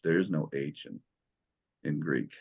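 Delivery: ordinary talk, nothing marked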